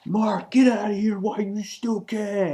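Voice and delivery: mock yell to flee; urgent warning. Hero urges Mark to flee.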